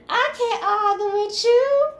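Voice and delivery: high, slow squeal; mock exasperation. Reader acting out a mad ex's quarrel, high mock squeal. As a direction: squeal it out, drawing out the words.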